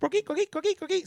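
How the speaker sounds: high chirpy frog call imitation; coqui frog call. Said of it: "little frogs called coqui".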